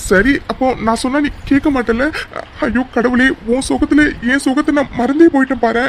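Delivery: high, hammed-up mock wailing; put-on crying. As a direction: fake a tearful lament to distract someone. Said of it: "எப்படிலாம் performance பண்ண வேண்டியது இருக்கு". (பொய்யாக உச்சக் குரலில் புலம்பி அழுவது)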